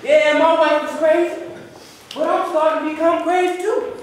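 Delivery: high, loud exasperated declaiming; high-pitched stage outburst; dramatic monologue. Acted. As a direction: cry it out to the audience. Performs monologue.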